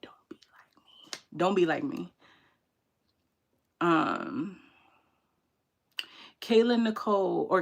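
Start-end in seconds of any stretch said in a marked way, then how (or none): 0.0-1.4 whispered and slowed down; soft whispered advice. Shown as a demonstration. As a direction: breathe it as a hushed warning. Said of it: "I'm like".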